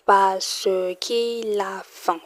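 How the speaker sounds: "Parce qu'il a faim" spelled out slowly, each syllable clear; slowly, so learners catch every sound; slow model sentence. Shown as a demonstration. Teacher slowing "Parce qu'il a faim" down for learners. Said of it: "teacher repeats the sentence".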